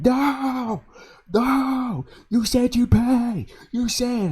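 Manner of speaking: hammed-up high-pitched whiny screaming; mock scream. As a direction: imitate the housemate throwing a fit. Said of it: "he was screaming".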